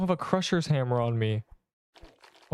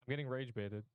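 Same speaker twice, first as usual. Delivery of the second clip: much softer, as if the mic failed; faking a cut-out mic; 14 dB quieter than usual. Shown as a demonstration. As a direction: drop the volume to nothing. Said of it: "Plug your mic back in".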